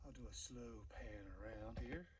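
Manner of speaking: soft, hushed voice, barely any sound; whispered narration. Real, not acted. Hiker panning the view narrates in a whisper. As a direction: whisper so the moment stays still. Quiet filming on the ridge.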